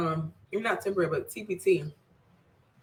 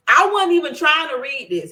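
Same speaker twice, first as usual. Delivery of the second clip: higher and louder, emphatic; excited; 8 semitones higher, 6 dB louder than usual. Real, not acted. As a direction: insist with excitement.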